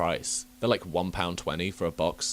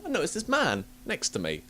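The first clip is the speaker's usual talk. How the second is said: usual speaking voice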